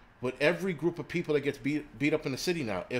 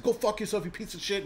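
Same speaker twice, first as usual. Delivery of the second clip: higher, hammed-up hostile retort; mock angry snap; 7 semitones higher than usual. Showing viewers how not to answer a stranger, hammed-up angry snap. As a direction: play the overreacting hothead. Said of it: "don't rip somebody's head off".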